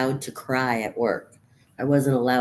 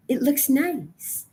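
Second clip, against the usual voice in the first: higher, slow sarcastic sweetness; sugary mock cheer; mock cheerful; 9 semitones higher, slower than usual. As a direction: sell it like a fake-happy ad.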